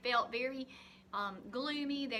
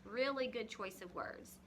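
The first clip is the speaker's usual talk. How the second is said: ordinary delivery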